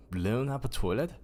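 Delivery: usual speaking voice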